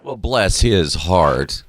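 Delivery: slow, drawn-out Southern drawl. Pretend. Host echoing the guest's story, mock Southern drawl. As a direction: stretch each word like a Southerner. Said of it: "That's Southern".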